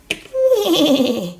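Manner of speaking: wavering, descending imitated neigh; whinny like a horse, sliding down. Little horsey arriving for the train, neighing. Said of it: "I am a little horsey".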